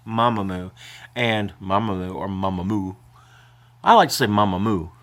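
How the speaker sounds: ordinary talk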